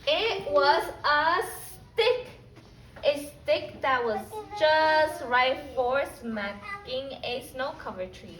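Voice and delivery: put-on lively storybook reading for little kids; reading to children. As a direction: read it like story time for a class. Teacher reads picture book aloud.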